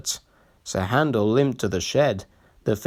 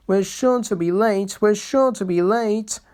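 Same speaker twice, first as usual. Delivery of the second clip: a lightly voiced, high, frantic little engine; frantic engine worrying about time; frantic panting; 8 semitones higher than usual. A narrator's reading. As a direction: pant it out in a hurry. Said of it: "panted Peter Sam frantically".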